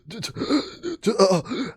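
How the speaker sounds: high, choked gasps with a cry; stabbed and gasping. Acted. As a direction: choke out broken sounds as the sword goes in. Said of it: "you see him go".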